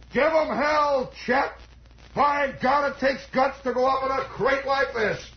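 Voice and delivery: grizzled mechanic yelling up; hollered encouragement. Retold or imitated. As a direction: call it up to the plane, hearty. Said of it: "One of them hollered up".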